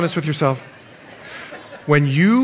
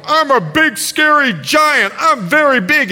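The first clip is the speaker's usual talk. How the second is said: big scary giant; 8 semitones higher than usual. Pretend. Preacher showing children the giant's part, big mock menace. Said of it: "I'm gonna be the big, scary giants".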